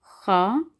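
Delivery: खा with a throaty scraped "kh"; guttural fricative starting खा; kha sound. Shown as a demonstration. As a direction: demonstrate the hard CH in खा. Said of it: "खा की आवाज़ आएगी".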